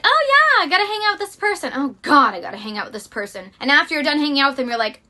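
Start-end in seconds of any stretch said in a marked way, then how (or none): 0.0-1.7 bright, high put-on cheer; fake enthusiasm. Faking joy about meeting a draining friend. 1.7-3.5 dreading groan. Retold or imitated. Admitting dread about seeing a draining friend.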